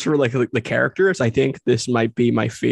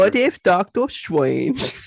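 uwu speak; 6 semitones higher, slower than usual. Pretend. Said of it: "uwu speak".

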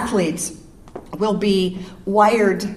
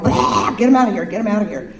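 quick brushing off; faster than usual. Shown as a demonstration. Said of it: "kinda go".

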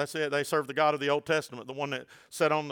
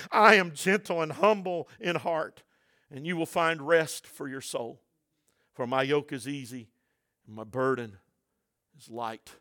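gentle tender voice; 3 semitones lower than usual. Acted. Jesus inviting the weary to rest, tender and softening. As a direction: let it fall to a tender hush. Quoting Jesus, fading softly.

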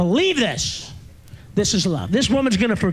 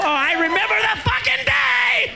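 shout; 12 semitones higher than usual. Real, not acted. Shouted memory of the day.

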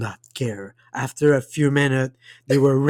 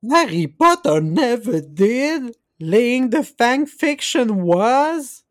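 higher and louder, hammed-up Dobby wail; mock anguished cry; 11 semitones higher, 5 dB louder, slower than usual. Shown as a demonstration. Host repeating Dobby's line as a louder, higher hammed-up wail. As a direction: wail it like a wounded house-elf, half-joking. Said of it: "Oh no".

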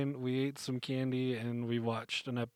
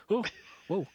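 normal talking voice

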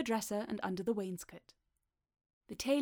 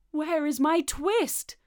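loud, high, slow demand; insistent demand; 7 semitones higher, 8 dB louder, slower than usual. A narrator's reading. Tailor pressing Simpkin for his twist, loud insistent demand. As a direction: draw it out loudly, rising at the end.

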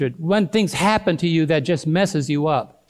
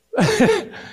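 laugh. Real, not acted. Laughing at own story.

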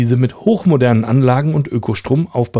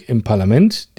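normal talking voice

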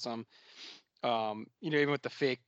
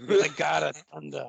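put-on Odin with a thick Boston accent; 3 semitones higher, 5 dB louder than usual. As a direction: drop the r in thunder, Boston style. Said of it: "with the Boston accent".

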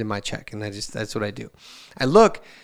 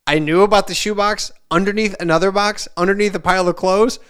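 higher, louder sarcastic reply; indignant retort; 6 semitones higher, 5 dB louder than usual. Retold or imitated. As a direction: throw her hiding spot back at her. Retorting to wife.

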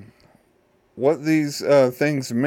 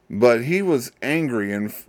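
usual speaking voice

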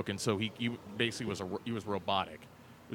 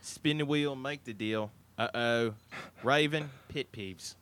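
put-on Australian accent; Aussie accent. Host taking on an accent for the show, Aussie. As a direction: speak as a chatty Aussie host. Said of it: "in an Aussie accent".

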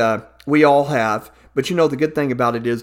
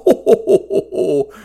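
jolly ho ho ho; 2 semitones higher than usual. Acted. Santa laughing.

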